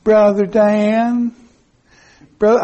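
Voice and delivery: slow, drawn-out fond call of a name; lightly voiced older woman calling his name; imitating a dear friend. Remembering a late friend calling him, slow and tender. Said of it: "I can still hear her saying".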